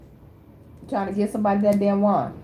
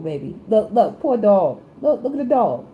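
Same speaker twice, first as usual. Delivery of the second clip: ordinary talk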